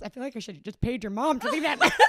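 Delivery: loud, fast quote through laughter; amused retelling. Retold or imitated. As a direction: rush the caller's line out, cracking up. Retelling caller's joke, laughing.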